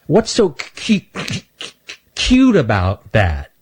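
high voice catching on the f, stammering; Stanley stammers out his excuse; stuttering squirrel. Acted. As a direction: get stuck on the f before it comes out.

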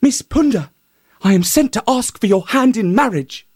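high, polite, courtly hare voice; the hare as a courtly suitor, high. A narrator's reading. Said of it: "in his most courtly manner".

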